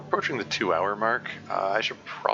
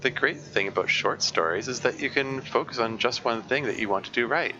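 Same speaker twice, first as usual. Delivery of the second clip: brisk read-aloud voice, a bit higher; 4 semitones higher than usual. Acted. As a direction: read the comic's advice out to the chat. Streamer reads comic's advice text aloud.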